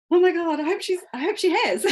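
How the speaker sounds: replaying her own thrilled reaction; excited.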